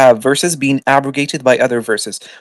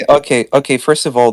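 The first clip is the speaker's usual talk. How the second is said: ordinary talk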